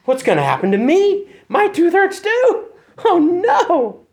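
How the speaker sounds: high, mock-scared inner voice, slow on my tooth hurts too; hammed-up dread, ending in a laugh; panicked worry. Patient waiting for the dentist frets about own tooth, high mock panic. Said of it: "And you're thinking".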